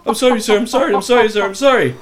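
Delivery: high, loud, rushed sorry sirs; the scared driver backpedaling fast; panicked apology; frantic apology. Retold or imitated. Driver apologizing after being yelled at.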